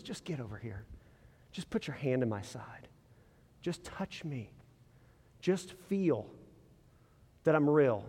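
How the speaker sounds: Jesus gently calling a doubter near, soft and tender. Retold or imitated. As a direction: coax him close, almost hushed. Pastor voicing Jesus inviting Thomas.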